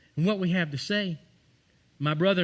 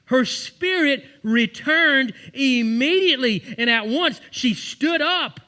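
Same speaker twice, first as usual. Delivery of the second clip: higher, louder, slow preaching climax; preaching the miracle, slow and loud; full-force preaching; 8 semitones higher, 7 dB louder, slower than usual. Acted. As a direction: build it like a revival preacher.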